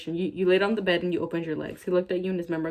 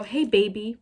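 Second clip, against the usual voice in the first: mock flirty voice; 3 semitones higher, slower than usual.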